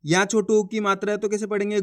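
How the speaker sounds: normal talking voice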